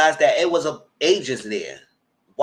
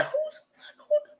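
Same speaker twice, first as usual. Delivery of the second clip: hushed whisper; 5 dB quieter, much faster than usual. Retold or imitated. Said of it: "but she said".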